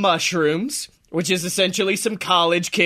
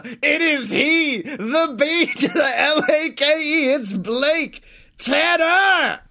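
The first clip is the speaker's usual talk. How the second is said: booming circus announcer intro; ringmaster; 3 semitones higher than usual. Pretend. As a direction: hype him up like a circus ringmaster. Ringmaster-style hype introduction.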